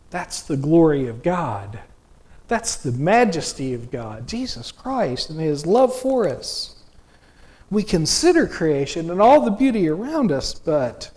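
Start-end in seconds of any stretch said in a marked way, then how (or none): none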